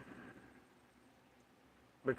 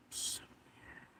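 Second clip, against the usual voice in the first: whisper; 20 dB quieter than usual. Real, not acted. Whispered aside after speaking.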